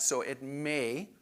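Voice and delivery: may said slow and weighted; stress on may. Shown as a demonstration. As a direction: lean on the word may, slowly. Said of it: "emphasis on the word may".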